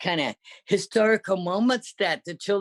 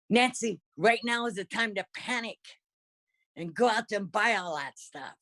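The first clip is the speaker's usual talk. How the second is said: mildly urgent retold instruction, higher at the start; urgent sister; 6 semitones higher than usual. Sister telling Nancy it is time to panic, retold with mild urgency. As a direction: imitate an exasperated sister spelling it out. Sister's urging voiced in story.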